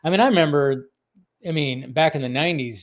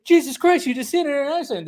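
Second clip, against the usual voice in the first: high, fast retold outburst; exclaimed movie quote; 10 semitones higher, faster than usual. Host re-enacting the censored film line, high rapid exclamation. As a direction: blurt it out in a high, hurried rush. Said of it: "and he says".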